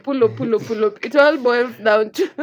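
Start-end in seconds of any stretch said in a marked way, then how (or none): 1.0-2.4 breaking into laughter mid-phrase; words giving way to real laughter; laughing. Real, not acted. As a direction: try to get the phrase out while cracking up. Breaks up laughing over a botched phrase.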